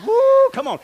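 high, loud woo; real excited cheer; excited whoop. Real, not acted. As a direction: cheer him on. Ball game demo with Matt.

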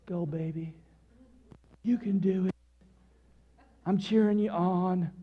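very soft, deadpan cheering; monotone. Acted. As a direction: cheer with no energy at all. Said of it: "do it in a monotone voice".

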